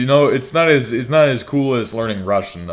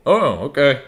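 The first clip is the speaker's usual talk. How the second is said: surprised teacher; 5 semitones higher, 4 dB louder than usual. Retold or imitated.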